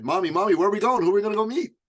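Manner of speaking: put-on excited small boy pestering his mom; mother quoting her excited little son, high and eager. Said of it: "my little son said".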